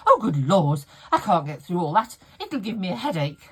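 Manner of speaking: loud, exasperated mum voice, hammed up; mock complaining mum. Mum grumbling at her daughter, loud mock exasperation. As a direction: blurt it like a fussy mum refusing puzzles. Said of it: "And she went".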